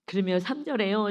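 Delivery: ordinary talk, nothing marked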